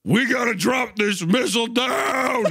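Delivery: high mock battle yell; game soldier shout. Soldier calling for the missile drop, mock shout. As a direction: yell it like an overacted action hero.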